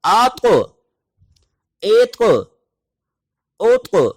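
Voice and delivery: teacher calls each syllable out high; qalqalah drill. Shown as a demonstration. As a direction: punch each ط so it echoes back. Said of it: "آواز پلٹ کر دوبارہ آئے گی".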